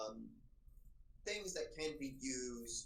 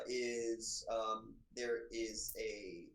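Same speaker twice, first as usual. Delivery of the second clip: ordinary delivery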